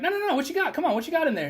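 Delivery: high, singsong "no, no, no"; catching him red-handed; sarcastic bust. Retold or imitated. Retold catching kid on phone.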